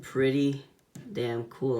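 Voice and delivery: ordinary delivery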